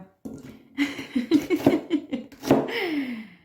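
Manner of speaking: a loud, high laugh that falls away; burst of laughter. Real, not acted. Cook laughing at her own onion tip, loud high laugh. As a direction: laugh it off at your own cooking joke.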